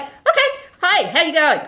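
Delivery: chirpy hostess greeting; work greeting. Retold or imitated. Greeting the client brightly.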